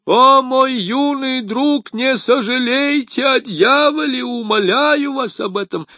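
a curé groaning his plea, high and loud; moaning curé. A narrator's reading. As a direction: beg him like a whimpering cleric. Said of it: "простонал Кюре".

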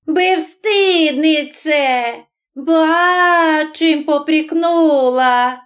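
high, drawn-out indignation; scandalised old woman's slow outcry; angry cry. A narrator's reading. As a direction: throw the reproach back, outraged and high. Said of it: "гнівливо крикнула баба з фіолетовим носом".

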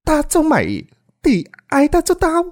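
vengeful woman shrieking back; hissing rage. Acted. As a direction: spit it out in hatred. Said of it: "Bà Lan rít lên".

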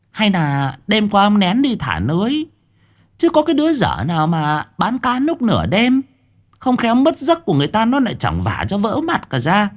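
high, doubting wife voice; suspicious wife. Acted. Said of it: "Bà nghi hoặc". (bà vợ nghi hoặc, giọng cao)

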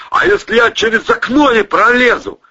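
loud, high worried cry; alarmed high-pitched call; panicked question. A narrator's reading. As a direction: shout your fear of being trapped. Acted panicked shout while sinking.

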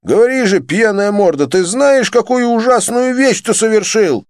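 high, loud angry demand; furious yell. A narrator's reading. Johnny yells at Joseph, furious. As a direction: yell the insult and demand an answer.

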